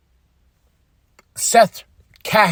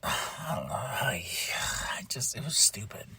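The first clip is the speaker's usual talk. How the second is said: sighing whisper, much softer; weary whisper; 7 semitones lower, 15 dB quieter than usual. Real, not acted. Vlogger fed up with the DQ ending, sighing whisper. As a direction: trail off under a sigh. Disgusted at the DQ finish.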